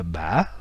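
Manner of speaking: ba avec remontée finale haute; ba au ton du tilde. Shown as a demonstration. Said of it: "et l'accent, euh, du tilde". (ba, accent du tilde)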